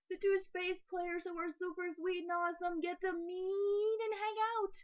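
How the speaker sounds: squealing high excited voice; gushing fangirl. Pretend. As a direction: gush like a thrilled fan. Gushing fan voice quote.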